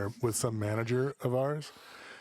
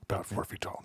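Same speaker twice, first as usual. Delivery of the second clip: hushed gossip aside; whispered jab; 4 semitones lower, 5 dB quieter than usual. Real, not acted. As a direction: whisper it like a secret.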